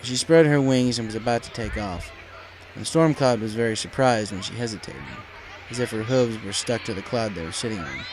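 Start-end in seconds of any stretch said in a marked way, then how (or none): none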